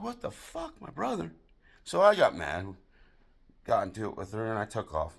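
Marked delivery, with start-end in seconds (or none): 0.0-1.3 high-pitched incredulous question; mock disbelief. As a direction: react in hushed, high disbelief. Stunned by girlfriend's confession.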